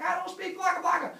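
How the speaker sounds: loud, high comic yell; panicked protest. Retold or imitated. Heracles yelling at babbling monsters, high frantic voice. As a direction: shriek back in mock desperation.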